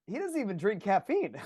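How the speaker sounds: retold boss line through laughter; boss's quip, half laughed; laughing quote. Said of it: "my boss goes".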